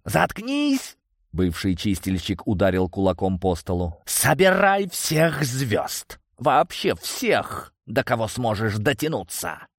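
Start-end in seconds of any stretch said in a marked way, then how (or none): 0.0-1.0 high-pitched furious cut-off. A narrator's reading. Villain silencing his adviser, furious. 4.1-9.7 high, drawn-out order; angry command. A narrator's reading. Leader banging the table, ordering a call-up, angry.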